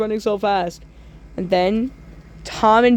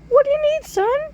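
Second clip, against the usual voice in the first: very high, squeaky clown voice; hammed-up high squeaky clown; bad Pennywise. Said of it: "That was pretty bad impression".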